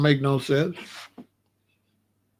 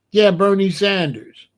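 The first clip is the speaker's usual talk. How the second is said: ordinary talk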